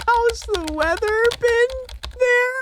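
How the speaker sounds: very high disbelieving tone; high dismayed squeal. Real, not acted. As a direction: squeak at the awkward line. Horrified at option.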